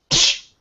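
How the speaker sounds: a sharp, airy "psh"; imitated smack. Player miming a harmless slap to the face, imitated smack. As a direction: make a slap sound with your mouth.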